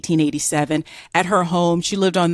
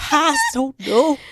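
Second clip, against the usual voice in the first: much higher Southern whimper broken by laughter; laughing mock Southern plea; 9 semitones higher than usual. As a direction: whimper it in a Southern accent, cracking up. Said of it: "I'm Southern, so I can play that".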